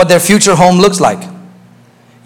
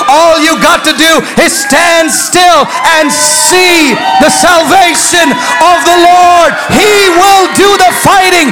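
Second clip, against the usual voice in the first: preaching at full force; 8 semitones higher than usual. Acted. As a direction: bring the church to its feet. Preaching climax, shouted.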